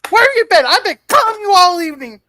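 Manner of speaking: very high, loud mock-scolding woman; imitated female caller. Co-host showing how to voice the woman on the phone, high hammed-up shout. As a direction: shriek it as an exaggerated indignant caller. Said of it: "you gotta do it like this".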